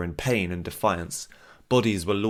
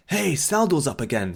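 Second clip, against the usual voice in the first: raised, higher shout across the room; calling out; 8 semitones higher, 4 dB louder than usual. Acted. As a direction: call out to the whole tavern. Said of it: "someone called".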